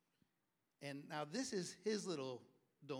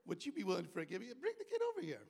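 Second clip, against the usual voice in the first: excited retelling; 7 semitones higher, 7 dB louder, faster than usual. Retold or imitated. Retelling cop and the kid.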